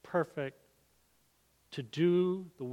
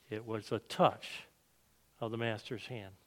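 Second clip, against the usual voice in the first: gentle, knowing smile in the voice; 6 semitones lower, 9 dB quieter than usual. A narrator's reading. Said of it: "he said with a smile".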